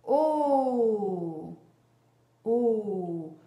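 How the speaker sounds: long "O" sliding gently down; rounded vowel. Shown as a demonstration. Teacher showing a child the round mouth, rounded vowel. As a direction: hold each "O" with rounded lips. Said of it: "La bouche, tu vois, elle est en rond".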